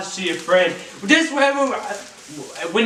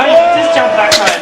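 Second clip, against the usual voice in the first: urgent shout; 3 semitones higher, 8 dB louder than usual. Real, not acted. Urging guy in video.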